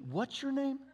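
high-pitched puzzled asking; incredulous question. Retold or imitated. Asking again in disbelief.

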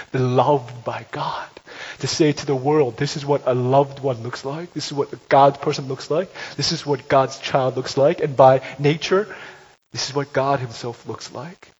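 full-voiced sermon climax; preaching at full force. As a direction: proclaim it to the whole room.

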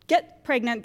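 normal talking voice

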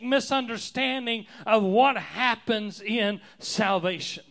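sermon climax shouted to the congregation; full-force preaching. Acted. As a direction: thunder it from the pulpit.

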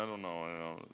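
low, soft doubtful mumble; unsure mumble. Retold or imitated. Doubting listener answering the preacher, low and soft. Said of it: "Some of you are going".

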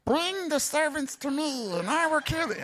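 a hint of a cartoonish villain giving orders; mock giant voice. Preacher jokes Goliath didn't talk like this, light mock giant voice. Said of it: "I don't think he talked like that".

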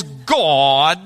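God drawn out low into three syllables; mock pompous minister stretching God; stretched solemn God. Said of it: "turn the one syllable word God into three syllables".

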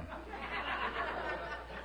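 high, breathless unvoiced giggle; high breathy laugh. Real, not acted. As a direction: laugh in disbelief, almost silent. Laughs at tall building remark.

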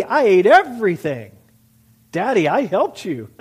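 loud acted kid calling to dad; child calling out. Children calling to their parent, acted loud calls. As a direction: call out eagerly, imitating a child. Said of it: "calling out to your parents".

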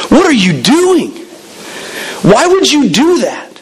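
harsh scolding. Acted. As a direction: scold her like she wasted a fortune. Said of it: "they scolded her harshly".

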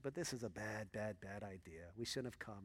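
low, soft, sulky grumble of a retold complaint; huffy muttering. Dad sulking to his wife in line, soft mock huff. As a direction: grumble it under your breath, a mock huff. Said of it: "getting all upset and huffing and puffing".

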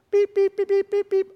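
high, loud beep beep beep; imitated keypad beeps. Pastor acting out locking the iPad in the safe, high imitated beeps. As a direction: mimic the safe's keypad tones.

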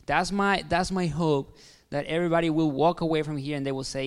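normal talking voice

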